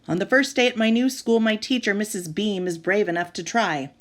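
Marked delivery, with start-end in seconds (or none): none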